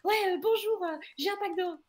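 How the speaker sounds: high, annoyed mock bonjour; irritated customer. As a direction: imitate an irritated customer's grudging hello. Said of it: "elle se vénère".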